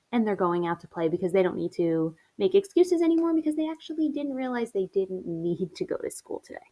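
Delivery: ordinary talk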